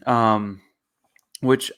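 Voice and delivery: normal talking voice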